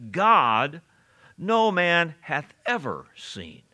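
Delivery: weighty pause after "God", emphatic "ever"; stress on EVER. Shown as a demonstration. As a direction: space the words out, leaning on "God" and "ever". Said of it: "notice how I emphasize that word ever".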